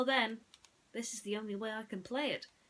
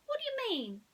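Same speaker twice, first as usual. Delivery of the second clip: put-on much higher girlish voice; sweet high shepherdess; Bo Peep voice. Bo Peep asks Ham, high girlish voice. Bo Peep's voice.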